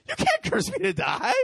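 very high, incredulous retort; amused disbelief. Acted. Woman answering the man's curse, high-pitched incredulity. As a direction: squeal it as if the idea is absurd. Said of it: "she starts laughing".

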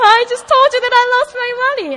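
high, loud, fast mock wail; mock tearful protest. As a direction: blurt it out like an exaggerated crybaby. Re-enacting her tearful protest to leader.

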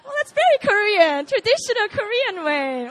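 loud, high mock-patronizing remark; condescending imitation. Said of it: "with that condescending look and, like, despising".

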